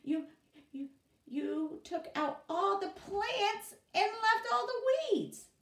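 very high, loud, slow stammering outrage; shocked outrage. Acted. Boss finds plants pulled, high outraged stammer. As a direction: sputter in disbelief and anger. Ben discovers weeds left.

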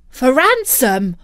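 put-on high, loud startled boy; shocked little outburst; startled cry. A narrator's reading. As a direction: cry out in alarmed disbelief. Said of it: "cried Jack".

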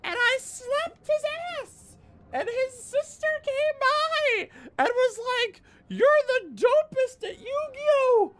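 very high falsetto storytelling; high squeaky voice. Acted. Mock sister voice, high.

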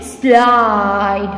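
"Slide" low, with a long middle vowel; pronunciation demo. Shown as a demonstration. Teacher showing how we pronounce it, drawn-out demo. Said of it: "How we will pronounce it?".

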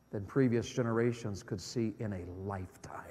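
hushed whisper of warning; grave whisper. Real, not acted. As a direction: drop to a whisper to sober them.

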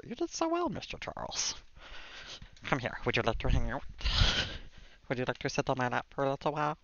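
put-on lightly done baby talk to the cat. Owner uses a hint of baby voice on her cat. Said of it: "It's hard to do the baby voice".

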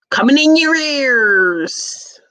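high, slow announcer-like holler; big, drawn-out catchphrase call. Acted. Calling out a catchphrase.

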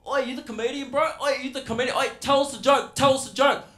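put-on high, loud nagging kid voice; a bogan kid yelling for a joke; pestering kid. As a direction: badger the teacher like a cheeky teen. Kid pestering comedian, acted.

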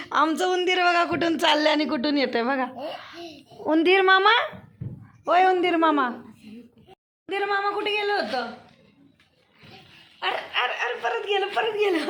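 loud, excited calling out; excited shout. Real, not acted. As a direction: call everyone to look, thrilled. Calling the playful pet. (उत्साहात मोठ्याने हाक)

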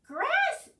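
high, loud disbelieving question; incredulous. Acted. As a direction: recoil at the offered food. Bird balks at offered grass.

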